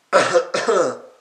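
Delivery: short, light cough to clear the throat; a mild demo cough, not the real hacking kind. Shown as a demonstration. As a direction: give a small polite throat-clear. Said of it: "clearing my throat".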